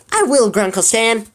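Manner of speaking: high and eager; bright, keen reply; enthusiastic. Acted. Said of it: "Mabel said enthusiastically".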